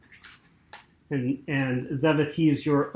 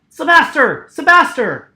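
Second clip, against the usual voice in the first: loud, high-pitched call; yelling out; 10 semitones higher, 8 dB louder than usual. Acted. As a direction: call out for a man across the boat. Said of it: "And he yells out".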